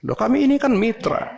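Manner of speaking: mock yell of an aggrieved partner; imitating a shout. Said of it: "Teriak-teriak".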